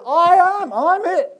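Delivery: high, loud, rising preaching; fervent proclamation. Acted. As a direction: proclaim it at full force. Climax of Jesus's "I am" list.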